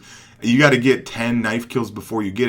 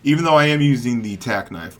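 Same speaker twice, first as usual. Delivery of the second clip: ordinary talk, nothing marked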